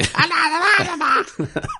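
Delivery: very high, strange cackle; eerie high cackle. Shown as a demonstration. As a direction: shriek like a cosmic demon. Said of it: "that goblin voice I did".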